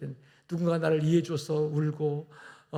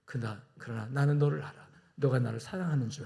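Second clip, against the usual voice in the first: tender Jesus; 4 semitones lower, 5 dB quieter than usual. Acted. Jesus voiced tenderly to Peter.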